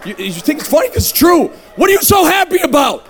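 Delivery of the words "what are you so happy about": high, exasperated mock yell; retold yelling. Newcomer yelling at the program staff, exasperated. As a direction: demand it in fed-up disbelief. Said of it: "That's what I would yell at these people in the staff".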